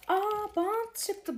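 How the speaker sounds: high, drawn-out delighted gasp of surprise; surprised. Real, not acted. Unboxing a surprise toy for viewers, slow high surprise. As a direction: stretch it out, amazed by the tape.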